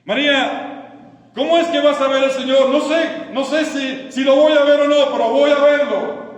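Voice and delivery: loud, high question and defiant answer; ringing, insistent resolve; impassioned determination. Acted. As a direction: preach it at full force. Acts challenge and Mary's defiant answer.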